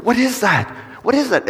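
high-pitched mock alarm; excited, high-voiced acted panic; freaking-out child. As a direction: imitate a startled toddler's amazement. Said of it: "freaking out".

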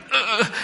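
a high, awkward "ugh" as the hugged man; embarrassed grunt. Retold or imitated. The stranger hugged by the grateful mom, awkward high grunt. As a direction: shrug it off with a squeaky grunt. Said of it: "he was kinda like".

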